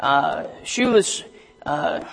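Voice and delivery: ordinary talk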